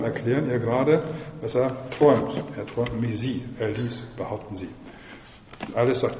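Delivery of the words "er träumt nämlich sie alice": plain reading voice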